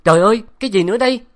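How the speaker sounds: high, loud, fast startled cry; startled shout. A narrator's reading. Professor touching the rabbit, loud startled shout. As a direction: yelp in shock. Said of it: "ông hét to".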